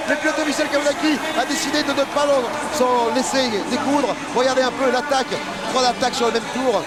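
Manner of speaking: high, fast, excited race commentary; motorsport commentator. Real, not acted. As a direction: sell the overtake with rising excitement. Live play-by-play of overtakes. (commentateur de course enthousiaste)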